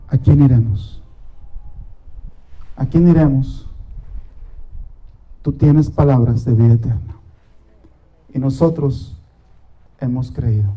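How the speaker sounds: low, hushed, unhurried prayer; oración íntima. Acted. Closing prayer, low and soft. (oración grave, lenta y recogida)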